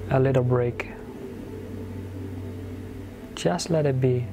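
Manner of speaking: ordinary delivery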